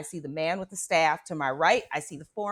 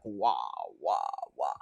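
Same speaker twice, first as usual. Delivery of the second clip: imitated portal noise; 6 dB quieter than usual. Portal bops her forehead.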